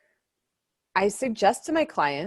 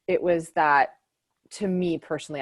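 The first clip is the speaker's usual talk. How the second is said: normal talking voice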